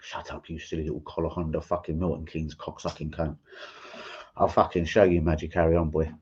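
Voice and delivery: low, quiet, contained insults; calm threat. Real, not acted. YouTuber answering the man who mocks him, menacingly calm. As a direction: keep the menace calm and soft. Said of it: "That's me, calm".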